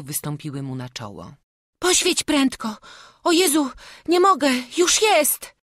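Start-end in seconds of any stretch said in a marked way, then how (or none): 1.8-5.5 high, loud, hurried plea; frantic child's voice; panicked boy. A narrator's reading. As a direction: scramble in high-pitched fright. Boys hurry in terror.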